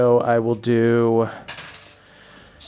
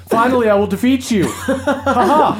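mock heroic boast; 8 semitones higher than usual. Son boasts to father.

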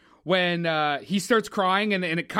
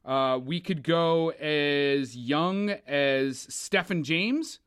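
ordinary talk

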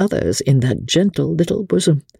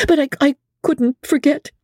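much higher, stammering and pleading; Amelia pleading; 12 semitones higher than usual. A narrator's reading. Amelia defending herself to Becky, stammering plea. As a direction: fragile young widow, faltering.

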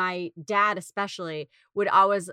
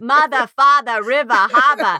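put-on loud r-less Boston accent; first grade in Boston, r-less accent; 3 semitones higher, 9 dB louder than usual. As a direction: drop every R like a Boston teacher. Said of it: "people are like".

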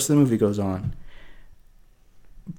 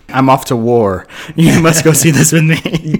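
a hint of a solemn soldier, louder and slower on the plea; mock-heroic departing soldier, a touch louder; guilt-tripping soldier; 3 semitones higher, 6 dB louder than usual. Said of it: "the military guilt trip".

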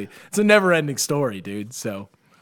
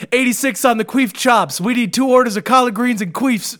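higher, louder mock head chef; barking kitchen orders; 7 semitones higher, 4 dB louder than usual. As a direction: call the orders like a busy chef. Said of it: "he's like".

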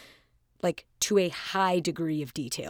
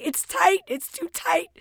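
much higher, louder, mock-desperate cry; exasperated outburst; 13 semitones higher, 6 dB louder than usual. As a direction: squeal it out like you've had enough. Retold cry about tight skin.

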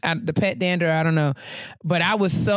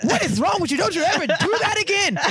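high, fast mock scold; scolding friends; 10 semitones higher, faster than usual. Friends cussing her out for the drive, a high mock scolding. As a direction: squeal it like a fussing friend. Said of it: "everyone was like".

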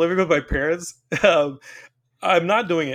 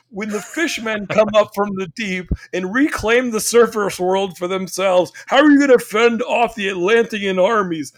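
mock doomsday stepdad; 5 semitones higher, 4 dB louder than usual. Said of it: "when he starts saying".

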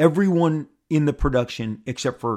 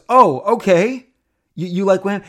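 surprised moderator; 8 semitones higher, 10 dB louder than usual. Retold or imitated. Moderator surprised by the fan's answer, high and loud. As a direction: act taken aback, then check.

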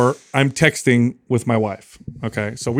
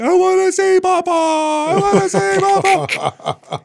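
put-on much higher toddler wail, the second line drawn out slow; imitating screaming son; slower than usual. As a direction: wail like a crying little boy who wants his dad. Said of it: "I hear my son screaming".

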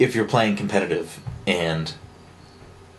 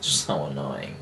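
lower, quieter weary mutter; 7 semitones lower, 7 dB quieter than usual. Real, not acted. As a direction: mutter it under your breath. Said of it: "repeated lower".

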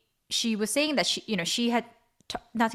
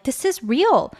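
insistent, raised-pitch plea; louder, emphatic appeal to be believed; pleading; 6 semitones higher, 5 dB louder than usual. Retold or imitated. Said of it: "sitting there pleading with them".

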